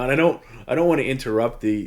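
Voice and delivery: ordinary delivery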